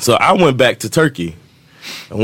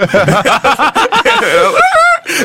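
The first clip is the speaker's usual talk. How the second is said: long, high-pitched cackle; real laughter; 4 dB louder than usual. Real, not acted. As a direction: crack up helplessly at your own story. Cracks up at his story.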